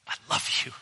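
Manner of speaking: hushed, whispered I love you; Jesus whispering love to the accused; tender whisper. Acted. Jesus wearing your jersey, tender.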